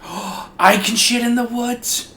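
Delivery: high, thrilled gasp then question; gasp, then ask in delight; excited. Acted.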